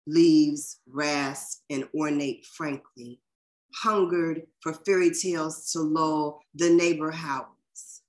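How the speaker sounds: slow, deliberate recital with long pauses; poetry reading. Acted. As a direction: savour each image, lines spaced apart. Reads another poet's poem.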